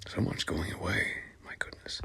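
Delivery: soft unvoiced aside to the audience; a dry whispered aside. Real, not acted. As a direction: whisper it under your breath. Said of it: "whispered aside".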